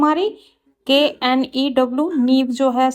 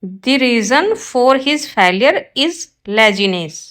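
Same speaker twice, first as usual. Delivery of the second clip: slow, careful read of the corrected sentence; slow teacher dictation; 3 dB louder, much slower than usual. Shown as a demonstration. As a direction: lay out each word clearly for the class. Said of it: "right होगा ये".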